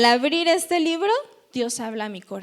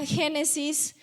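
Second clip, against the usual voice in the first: normal talking voice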